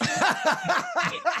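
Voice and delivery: sudden high burst of laughter; high, amused cackle. Real, not acted. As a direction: crack up at your own joke. Laughs at own punch fantasy.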